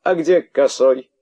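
high, loud outraged rant. Acted. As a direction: sputter with indignation. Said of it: "не находя слов от возмущения".

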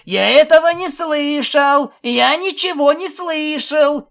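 high, loud, drawn-out chant; singsong yelling. A narrator's reading. Colt with plugged ears refuses to listen, singsong yelling. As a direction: yell it in a stretched singsong to drown out talk. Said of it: "заорал нараспев".

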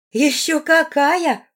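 high, loud, drawn-out relish; smiling. A narrator's reading. Сильвана agreeing with Руфус about her talent, smiling. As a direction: draw it out high with a smile. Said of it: "улыбнулась ему Сильвана".

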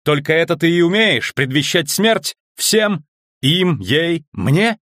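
high, indignant challenge; heated, high-pitched outburst; crying out. A narrator's reading. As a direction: fling the accusation at the enemy. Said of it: "крикнула Трис".

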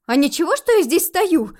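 high, loud, affronted question; indignant outburst. A narrator's reading. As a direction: demand it loudly, affronted. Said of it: "снова возмутилась я".

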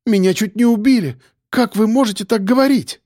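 high, indignant appeal; shocked cry. A narrator's reading. Woman who was nearly killed rebuking the men, upset cry. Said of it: "вскрикнула она".

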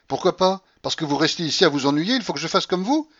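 high, loud retort; indignant old grandmother. Acted. Grandmother defends her return to roulette, indignant. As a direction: snap back defiantly.

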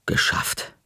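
ordinary delivery, nothing marked

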